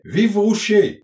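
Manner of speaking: loud, high-pitched acclamation; shouted cheer. A narrator's reading. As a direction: cheer it out loudly, pitch jumping up. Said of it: "il criait". (acclamation criée, forte et aiguë)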